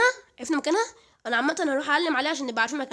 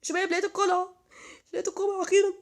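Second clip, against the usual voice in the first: excited announcement; 7 semitones higher than usual. Real, not acted. Announcing he found the castle.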